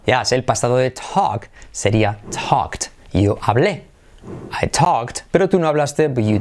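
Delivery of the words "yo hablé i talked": ordinary delivery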